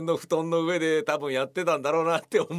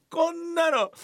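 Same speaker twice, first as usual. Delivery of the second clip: high, louder mock huff; mock indignation; 13 semitones higher, 3 dB louder than usual. Mock indignation about the leaked prop. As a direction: pretend to be outraged. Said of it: "mock indignation".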